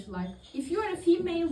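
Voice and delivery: normal talking voice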